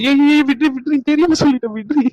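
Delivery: put-on a boyfriend yelping under playful blows; pained pleading. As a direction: beg her to stop hitting. Being hit, begs her stop.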